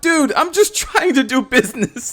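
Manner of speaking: high, loud squeaky mock protest; a hammed-up high, flustered whine. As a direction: squeak out an exasperated excuse. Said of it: "I'm like".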